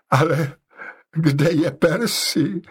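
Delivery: high, drawn-out wail, understated; vzlykání. A narrator's reading. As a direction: wail the question slowly without real sobs. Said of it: "vzlikala ubohá paní X". (mírně plačtivě)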